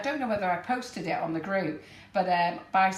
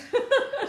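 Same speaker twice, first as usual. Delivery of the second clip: high burst of laughter; 4 dB louder than usual. Real, not acted. Laughing at friend's wedding story.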